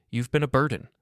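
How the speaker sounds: forceful, contemptuous interjection; cutting in. A narrator's reading. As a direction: cut across them with an accusation. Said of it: "Vanessa interrupted".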